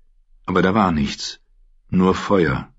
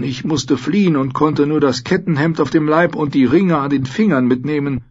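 high-pitched, fussy lord explaining himself; old noble prisoner, apologetic; 7 semitones higher than usual. A narrator's reading. Lord apologising to a knight for his appearance, flustered. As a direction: play a high-voiced lord excusing himself.

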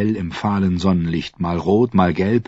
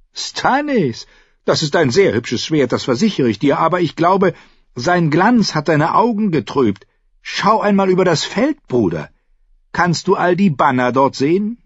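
high, airy condescension to his brother; mock the brother lightly in a high voice; Renly, amused; 11 semitones higher, 4 dB louder than usual. A narrator's reading. (hohe, amüsiert spöttische Stimme)

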